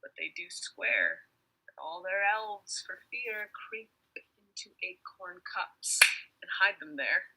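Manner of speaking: hushed, breathy fairy whisper. Acted. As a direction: whisper it like a secret.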